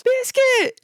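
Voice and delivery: shouting the pet's name high; calling out. Acted. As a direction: call out to the pet. Kid calling pet.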